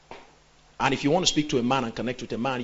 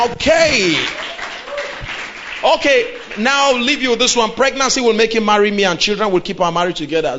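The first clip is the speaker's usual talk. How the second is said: loud, driving sermon delivery; full-force preaching; 5 semitones higher, 8 dB louder than usual. Acted. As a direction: preach it hard to the congregation.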